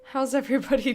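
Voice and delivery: usual speaking voice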